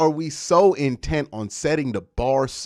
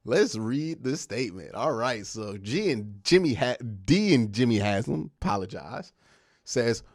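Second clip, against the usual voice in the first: usual speaking voice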